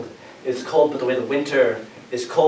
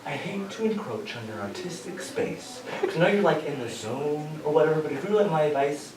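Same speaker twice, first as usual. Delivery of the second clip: soft, hushed, gentle advice from a dog; a dog quietly offering advice; calm dog voice; 9 dB quieter than usual. Pretend. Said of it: "my dog looks up from her water dish and says".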